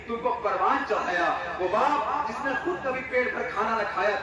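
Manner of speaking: ordinary talk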